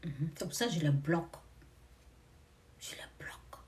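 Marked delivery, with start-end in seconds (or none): 2.8-3.6 breathy whispered aside. Real, not acted. Telling listeners how she blocks critics, in a whisper. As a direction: drop to a secret whisper.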